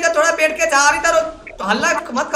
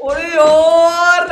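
loud drawn-out delight; 4 semitones higher, 8 dB louder, much slower than usual. Pretend. Prank caller thrilled to find girl.

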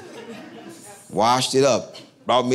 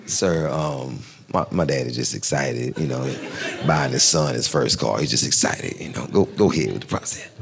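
smooth-talking son; 9 semitones lower than usual. Retold or imitated. Smoothing it over with salesman.

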